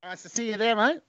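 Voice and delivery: put-on high-pitched Australian accent. Streamer thanking an Aussie donor, in a put-on Australian accent. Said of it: "do the Australian accent without swearing".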